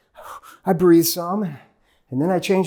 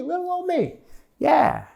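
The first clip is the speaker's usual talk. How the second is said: mock modest; 10 semitones higher than usual. Self-mocking about big profits.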